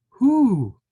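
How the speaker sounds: high loud questioning who; a little voice begging for an answer; pleading who. Acted. Pika asks the mountain monarch, high and loud.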